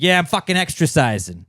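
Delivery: loud New York tough guy; New York accent. Retold or imitated. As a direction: shout it like a New Yorker. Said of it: "a more Italian accent or more like New York".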